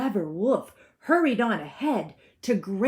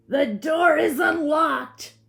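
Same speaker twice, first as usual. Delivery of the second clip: 1 loud wolf; 9 semitones higher, 8 dB louder than usual. A narrator's reading. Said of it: "said the wolf".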